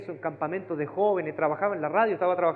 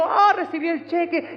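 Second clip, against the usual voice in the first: mock delight; 11 semitones higher, 7 dB louder than usual. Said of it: "y yo".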